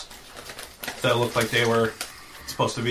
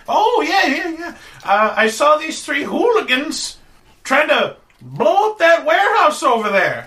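put-on very old woman; 13 semitones higher, 4 dB louder than usual. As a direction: play a frail old woman. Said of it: "this very old lady".

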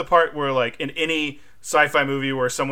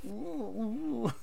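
high, much softer cringing ooh; mock wince; 7 semitones higher, 12 dB quieter than usual. Host imitating a viewer cringing at old jokes, soft and high. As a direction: wince at something that aged badly. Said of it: "you kinda go".

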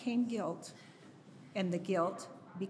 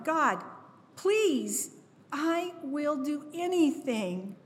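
higher, pleading prayer, stretched out slow at the end; desperate plea; 5 semitones higher, slower than usual. Retold or imitated. A grieving mother begging God for a baby, desperate plea. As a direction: beg God as if nothing else is left.

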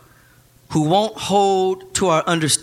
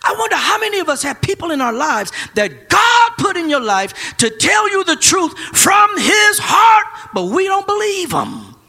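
full-force preaching; 12 semitones higher, 5 dB louder than usual. Acted. Preacher crying out to the congregation, loud. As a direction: whoop it to the rafters.

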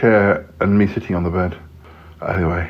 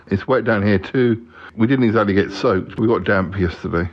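ordinary delivery

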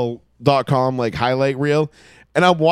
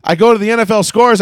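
ordinary delivery

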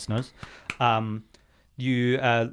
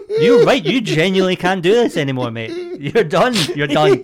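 high, loud exasperated rant; mock outrage; 7 semitones higher, 9 dB louder than usual. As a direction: tell him he's finished, half laughing.

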